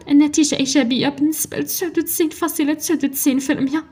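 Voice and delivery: high, emotional trembling voice, overcome with relief. A narrator's reading. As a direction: read it high and tremulous, like a mother who found her daughter. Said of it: "الليلة بصوت مرعود". (صوت مرعود وعالي من التأثر)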